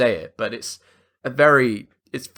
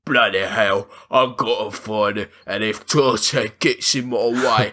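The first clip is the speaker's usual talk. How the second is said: low, hammed-up cockney-style British villain; exaggerated British accent; 4 semitones lower than usual. Shotgun man after the diamond, overblown British accent. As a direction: lay on a terrible fake British accent. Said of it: "the most exaggerated accent ever".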